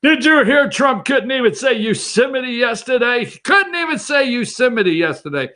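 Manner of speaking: high, gleeful mock gossip voice; mocking Democrats gossip. Host mocking Democrats gossiping about Trump, high and gleeful. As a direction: tattle like a giddy gossip. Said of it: "the Democrats going crazy now".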